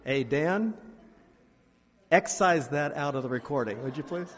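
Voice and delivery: loud call across the room to Dan; playful loud aside to the recording tech. Real, not acted. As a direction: call out to the sound man, half joking. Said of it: "calls out to sound tech".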